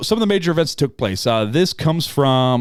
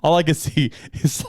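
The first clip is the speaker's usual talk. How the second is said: ordinary talk, nothing marked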